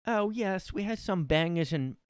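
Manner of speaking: high, badly faked British accent. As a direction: attempt a fussy high British voice. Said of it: "man, I can't do the accent".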